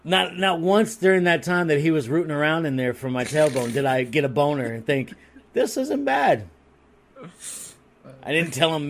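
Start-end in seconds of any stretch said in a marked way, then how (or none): 5.5-6.5 mock pleased thought. Imagined inner thought, high voice.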